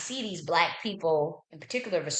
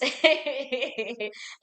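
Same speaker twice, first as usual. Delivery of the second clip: laugh; 6 semitones higher than usual. Real, not acted. Laughs at own distraction.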